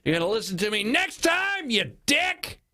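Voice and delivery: put-on high, strained yell as the boy; mock yell. Boy chucks it on shore to alert dad, mock yell. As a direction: cry out high, then scold dad. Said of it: "chuck it on shore and go".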